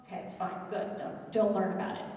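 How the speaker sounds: ordinary talk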